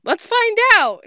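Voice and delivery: high, sing-out eagerness; eager exclamation. A narrator's reading. Grape decides to test it on herself, eager. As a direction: pitch leaping way up, eager.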